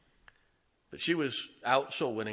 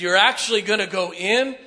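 much higher and louder, disbelieving; incredulous shout; 8 semitones higher, 13 dB louder than usual. Acted. Doubting followers shout at Jesus by the grave, incredulous. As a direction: cry out in disbelief.

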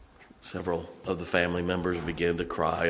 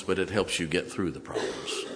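ordinary talk

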